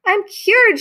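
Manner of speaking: high excited cry; shouted. Acted. Camilla cured at last, high joyful cry. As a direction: cheer it out with joy. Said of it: "she shouted".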